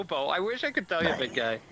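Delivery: normal talking voice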